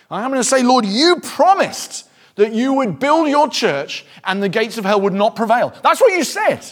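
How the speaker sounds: loud, high, urgent preacher; fervent preaching. Acted. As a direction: preach it with full force. Preaching at full force.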